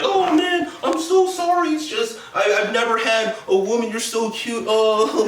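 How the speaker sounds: high, whiny simpering nerd; high pleading creep voice; pathetic incel voice. Retold or imitated. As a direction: squeak it out like a lovesick loser. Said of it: "and he's like".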